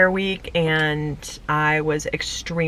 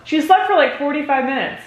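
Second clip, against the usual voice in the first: surprised emphasis; 8 semitones higher than usual. Real, not acted. Repeats for partner.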